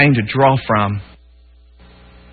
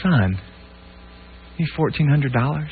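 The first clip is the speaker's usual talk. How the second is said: gentle, quiet dad; 10 dB quieter than usual. Retold or imitated. Dad leaning in to son.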